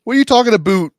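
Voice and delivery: high, loud put-on Canadian; mock Canadian accent. Teasing a Canadian co-host about the accent, mock Canadian. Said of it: "Yeah, a boot".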